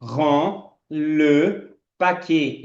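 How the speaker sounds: very slow, stretched French; very slow demo. Shown as a demonstration. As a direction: draw out each sound for learners. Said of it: "fechando os lábios". (francês bem arrastado)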